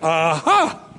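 a hammed-up aha, pointing someone out; mock triumphant aha. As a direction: catch someone out, playfully. Disciples' mock accusation.

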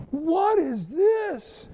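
high, slow, stunned question; startled disbelief. Retold or imitated. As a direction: pitch it way up in shock at the sight. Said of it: "And I'm going".

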